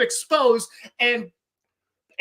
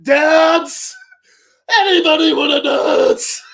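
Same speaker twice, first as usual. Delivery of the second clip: much higher, loud, hammed-up stripper call; South Park stripper; 7 semitones higher, 9 dB louder, slower than usual. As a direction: belt it like a club stripper. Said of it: "sounds like the fucking stripper from South Park".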